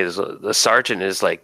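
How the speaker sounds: ordinary delivery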